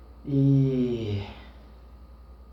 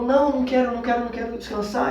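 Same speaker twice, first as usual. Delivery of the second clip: desperate refusal; 7 semitones higher, faster than usual. Retold or imitated. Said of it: "eu ficava mais desesperado".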